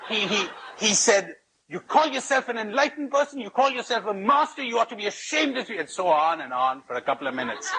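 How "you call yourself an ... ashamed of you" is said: higher, fast indignant tirade, retold; outraged protest. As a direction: imitate a man livid with rage, pitched up. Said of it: "the man went livid with rage".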